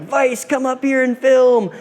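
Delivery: high, drawn-out imitated announcement; mock megaphone holler. Party skater on a megaphone summoning the film crew, mock holler. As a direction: mimic a heckler calling over a megaphone. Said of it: "on the megaphone, and they were like".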